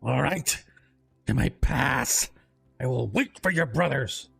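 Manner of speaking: lightly voiced growling troll under a bridge; a hint of a gruff bridge troll. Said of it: "deep, growling, gruff voice from below".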